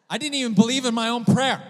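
high, loud exclamation; loud comic confession. Real, not acted. Preacher admits doubting his own healing prayer, loud and high. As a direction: exclaim it in exasperated disbelief.

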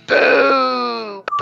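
high, loud jeering hag cry; old hag booing. Retold or imitated. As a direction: boo her like a scornful old crone. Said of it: "And she's like".

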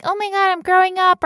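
high, loud mock teenage panic; panicky teen. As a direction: squeal it like a freaked-out teen. Teen panic voiced by host.

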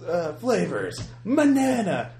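high excited commercial shout; hyped ad voice. Pretend. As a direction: sell it like a cheesy ad. Mock energy drink ad.

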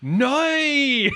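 high loud Belfast "Nay!" in place of "Oh!"; Northern Irish "Nay!". Retold or imitated. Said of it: "But she's".

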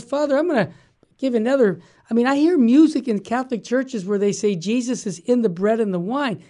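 usual speaking voice

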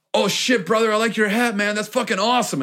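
high, hyped-up fan voice; gushing way up high over a cool hat; excited fan gush. Retold or imitated. Fan gushing at a stranger's hat, high and hyped.

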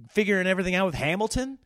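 ordinary talk, nothing marked

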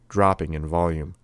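ordinary delivery, nothing marked

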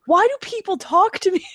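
high, loud mock despair; mock wail. Podcaster cringing at her own recorded voice, mock wail. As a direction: wail it in exaggerated dismay.

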